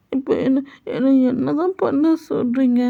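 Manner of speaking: put-on helpless childlike. Jungkook asking the mother what to do, high helpless voice. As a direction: ask as if lost.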